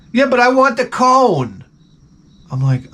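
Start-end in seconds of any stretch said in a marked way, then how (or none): 0.1-1.6 put-on high, insistent kid voice; high-pitched mock pleading; whining young son. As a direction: imitate a child who won't give up. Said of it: "and my son's like".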